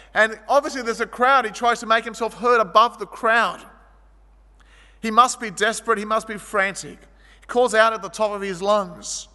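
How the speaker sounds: ordinary delivery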